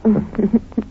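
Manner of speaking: a light, pleased laugh; laugh, charmed by him; delighted laugh. Acted. Laughs at his joke.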